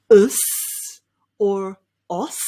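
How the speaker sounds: US then OS, slowly, set apart; slow US versus OS with held s; contrasting syllables. Shown as a demonstration. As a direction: sound out "us" as uhss, "os" as oss. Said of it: "So whether you say".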